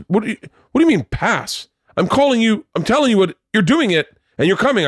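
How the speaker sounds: high-pitched exasperated ordering; insistent, outraged mock command; indignant bossing. Caller orders him after refusal.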